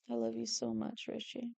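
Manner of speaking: soft, hushed declaration of love; tender adoration. Real, not acted. Artist to Richie on the page, soft tenderness. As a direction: murmur it fondly. Adoring the character being drawn.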